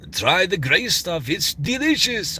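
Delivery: high, slow French-accented showman; Lumiere. Shown as a demonstration. Streamer retrying a Beauty and the Beast character, high French accent. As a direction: draw it out in French accent, soaring on delicious. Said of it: "tried to do Lumiere".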